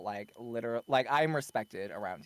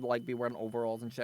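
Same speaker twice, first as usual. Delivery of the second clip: ordinary delivery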